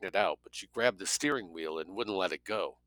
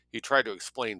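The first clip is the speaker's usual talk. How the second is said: ordinary delivery, nothing marked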